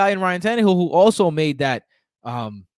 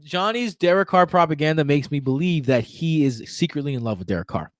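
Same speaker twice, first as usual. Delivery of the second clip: ordinary talk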